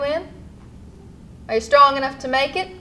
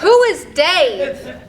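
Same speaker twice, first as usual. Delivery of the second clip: laughing; 3 semitones higher, much slower than usual. Acted. Amused question.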